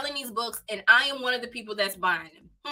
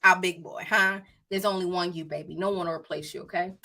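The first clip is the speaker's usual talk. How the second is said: tender reassurance; 3 semitones lower than usual. Real, not acted. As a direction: comfort him gently so he won't feel left out. Soothing her big dog.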